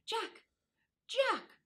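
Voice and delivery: high urgent calling, held back; loud whisper call. Acted. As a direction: call the dog without waking anyone. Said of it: "called out in a loud whisper".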